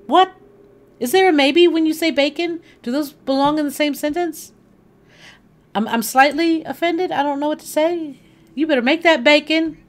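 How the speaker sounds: high, loud playful indignation; mock outrage. Real, not acted. Mock offense over "maybe bacon.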